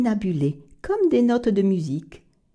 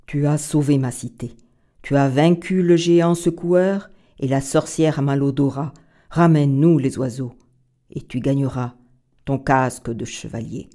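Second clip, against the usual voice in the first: king's voice; 6 semitones lower than usual. Acted. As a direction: speak as a grave old king. King speaks to Arturo. (le roi, voix grave et solennelle)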